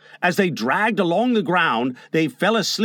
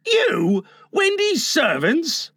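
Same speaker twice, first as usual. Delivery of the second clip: high, drawn-out disbelief; appalled; 11 semitones higher, slower than usual. A narrator's reading. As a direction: question it incredulously, pitch soaring. John appalled at being made servants.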